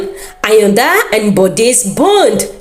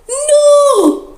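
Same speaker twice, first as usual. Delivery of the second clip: high, anguished cry of protest; cried out; 13 semitones higher, much slower than usual. A narrator's reading. Soldiers crying out to stop their captain, high anguished protest. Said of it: "his men cried".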